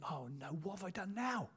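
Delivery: put-on hushed child's "oh no"; soft, lightly acted alarm; mock dread. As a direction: play a worried kid under your breath. Child dreading dad's approach, acted.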